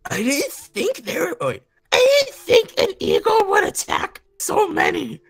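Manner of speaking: high, breathless cat voice; gasping. Acted. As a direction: gasp it out in shock. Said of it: "Squirrelpaw gasped".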